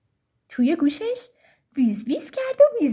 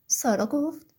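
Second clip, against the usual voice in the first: plain reading voice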